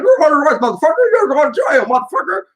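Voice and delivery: high, loud barking cop; imitating the arresting officer's yell; mock cop yell. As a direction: bark the arrest at him. Cop tackles and arrests him.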